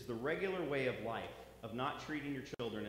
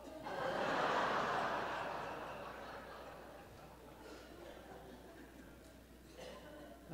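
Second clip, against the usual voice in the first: a long breathy chuckle after the punchline; long airy laugh. Real, not acted. Pastor laughing after the Seven Up punchline, long breathy laugh. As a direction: laugh long and airy at your own joke.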